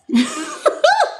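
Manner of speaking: a sudden high-pitched giggle. Real, not acted. Laughing at herself while setting up the phone, high giggle.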